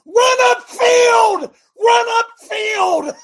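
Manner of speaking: high, loud, drawn-out yell at the players. Retold or imitated. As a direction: bellow it at the screen like a frantic fan. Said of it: "you know what I'm yelling?".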